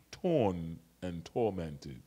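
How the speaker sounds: "Torn and tormented" said slowly and softly, each word laid down; weighted repeat. Acted. Repeated phrase, slowed for weight.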